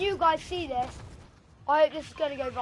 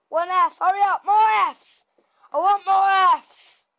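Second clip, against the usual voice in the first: loud, higher, urgent demand; calling for more; 4 semitones higher, 8 dB louder than usual. Real, not acted. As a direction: yell at chat to hurry up. Streamer demanding Fs from chat.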